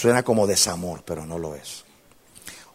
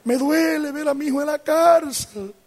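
high, climbing voice of a distraught mother; imitated tearful plea. Mother begs pastor over jailed son, imitated tearful plea. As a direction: act out a mother begging in tears, pitch rising.

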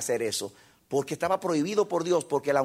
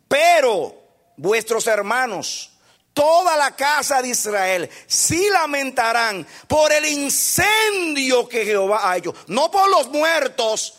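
loud, high, slow scripture proclamation; pounding pulpit reading, every phrase hammered; preaching at full force; 7 semitones higher, 6 dB louder, slower than usual. Acted. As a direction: thunder the verse out to the congregation.

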